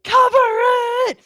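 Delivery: loud, high mock shout from fans; shouted demand. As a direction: yell it like an angry crowd. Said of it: "the people behind the phones are now being like".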